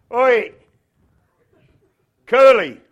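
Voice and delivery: loud, high hollered call; rough brotherly yell for attention. Acted. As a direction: holler to someone far off. Brother calling across to David.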